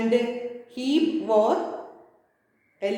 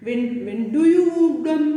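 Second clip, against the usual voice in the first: lightly put-on German accent, a little louder; 3 semitones higher, 4 dB louder than usual. Shoemaker asking the customer, mild German accent. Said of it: "this is the accent of German".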